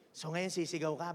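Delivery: ordinary talk, nothing marked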